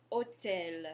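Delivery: Hôtel with a mute H; Hôtel, the H silent; French hôtel. Shown as a demonstration. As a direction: confirm Hôtel for the learner. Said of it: "your H is mute".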